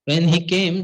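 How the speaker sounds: normal talking voice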